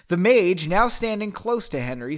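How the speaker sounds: ordinary delivery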